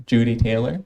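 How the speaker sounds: ordinary talk, nothing marked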